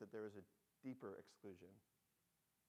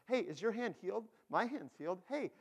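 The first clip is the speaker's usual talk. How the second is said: higher, louder excited chatter; excited lepers; 9 semitones higher, 10 dB louder than usual. Acted. Healed lepers check each other's hands and arms, excited. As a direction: blurt it out in amazed excitement. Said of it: "they're, like, all excited about it".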